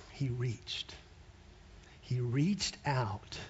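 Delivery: slow, hushed, reverent; slow it down to a near hush. Acted. Dramatic climax of healing.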